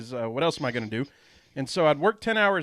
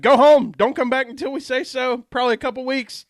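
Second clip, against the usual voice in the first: loud, high mock command from HR; mock email order; 7 semitones higher, 6 dB louder than usual. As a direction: bark out a retold company order. Acting out HR's blunt email order.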